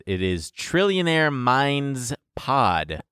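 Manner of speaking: Trillionaire Minds Pod said slowly, each word spelled out clearly; slow down to spell out the name Trillionaire Minds Pod. Shown as a demonstration. Host slowly announces the subreddit name Trillionaire Minds Pod to listeners. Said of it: "instead of the E T at the end, it's P O D".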